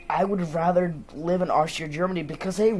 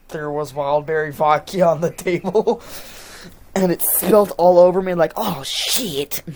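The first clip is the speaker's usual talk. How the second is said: exclaiming retort; 3 semitones higher, 8 dB louder than usual. Retold or imitated. Exclaiming as vodka spills over them.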